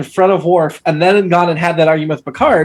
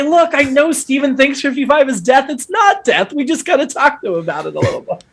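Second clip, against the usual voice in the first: ordinary talk, nothing marked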